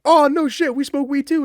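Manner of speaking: high, loud mock-excited exclamation; excited friends. Friends finding out he smokes too, loud excited cheer. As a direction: burst out like thrilled buddies. Friends thrilled he has weed.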